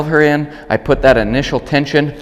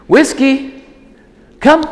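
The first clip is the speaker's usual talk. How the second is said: high, loud call across the yard; high dog-recall call; 5 dB louder, much slower than usual. Shown as a demonstration. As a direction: call her name bright and high. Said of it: "coming to me".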